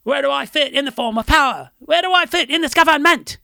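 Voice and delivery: put-on high, loud English punk accent. Quoting the band's song lyrics in an English punk accent. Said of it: "one hundred percent English accent".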